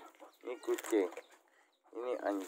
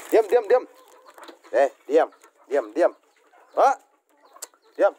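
loud repeated command to the animals; 5 semitones higher, 10 dB louder than usual. Real, not acted. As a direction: bark the order to sit. Hushing noisy animals. (perintah keras berulang kepada hewan)

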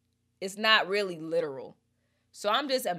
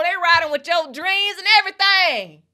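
excited outcry; 11 semitones higher, 13 dB louder than usual. Real, not acted. Peak of her reaction.

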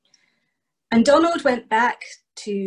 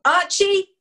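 calling out; 7 semitones higher, slower than usual. Acted. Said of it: "Donald's voice came crying over the hill".

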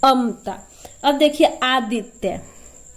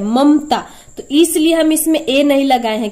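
normal talking voice

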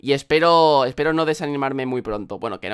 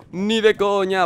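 higher, firmer repeat; emphatic; 4 semitones higher, slower than usual. Real, not acted. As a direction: deny it outright.

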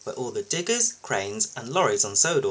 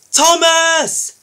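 high, very loud drawn-out shout; urgent yell to a friend in danger; shouted warning; 10 dB louder than usual. A narrator's reading. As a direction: shout the name in alarm. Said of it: "he shouted".

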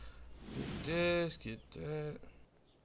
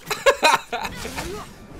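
high-pitched burst of laughter; amused laugh; 11 semitones higher, 4 dB louder than usual. Real, not acted. Gamer watching opponent miss swings, amused laugh.

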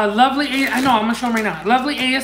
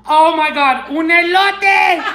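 thrilled exclamation; 5 semitones higher, 4 dB louder than usual. Real, not acted. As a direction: draw it out, pitch rising. Thrilled by corn sticker.

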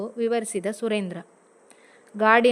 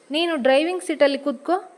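higher, fast, mildly raised husband's push; mildly raised, impatient husband; a bit louder; 6 semitones higher than usual. Acted. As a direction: nudge her on with a slightly raised voice. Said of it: "ಸ್ವಲ್ಪ ಜೋರಾಗಿ ಹೇಳಿದ".

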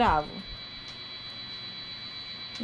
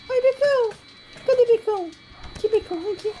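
high dog talk; 9 semitones higher, faster than usual. Real, not acted. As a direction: coo up at the dog. (voz fininha chamando o cachorro)

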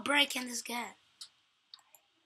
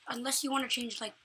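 usual speaking voice

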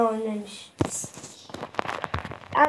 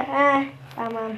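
usual speaking voice

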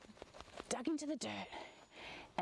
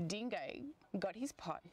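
plain reading voice

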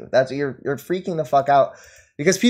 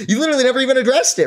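fast, laughing disbelief; 8 semitones higher, much faster than usual. Real, not acted. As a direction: rush it out through a laugh. Laughs mocking a rival.

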